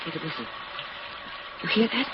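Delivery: hushed alarm. Acted. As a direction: hush him, straining to hear. Wife hears something in the dark.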